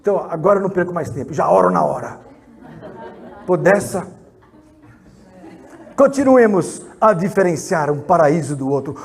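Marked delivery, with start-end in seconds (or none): none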